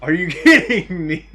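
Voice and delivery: loud laughing disbelief; surprised shout. Real, not acted. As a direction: yell it mid-laugh.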